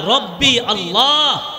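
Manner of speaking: "রব্বি আল্লাহ" drawn out slowly, word by word; slow answer. Shown as a demonstration. Preacher giving the grave answer to listeners, slow and solemn.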